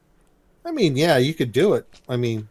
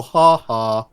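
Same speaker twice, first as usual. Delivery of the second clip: cartoon chuckle; 9 dB louder than usual. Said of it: "big chuckle".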